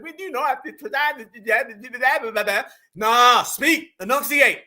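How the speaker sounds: mock suppressed African accent, garbled babble; garbled imitation of a hidden African accent; fighting African accent. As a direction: stumble over syllables, fighting an African accent. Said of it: "He fighting that African accent hard as hell".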